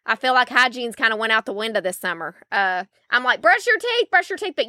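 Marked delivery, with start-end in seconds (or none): 3.4-4.5 high-pitched mock nag; retold nagging. Mom reminding her kids about toothbrushing, high mock nag.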